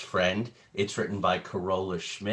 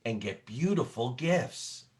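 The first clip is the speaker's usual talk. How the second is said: excited read-aloud, higher and louder at the party invitation; dramatic storybook reading; 6 semitones higher, 6 dB louder than usual. Acted. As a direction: sell the party to a young listener. Reading picture book aloud dramatically.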